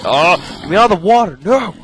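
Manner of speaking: loud, high, rising cry of alarm; panicked yell. Real, not acted. Gamer dying and grabbing for water, panicked yell. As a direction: yell desperately as it all goes wrong.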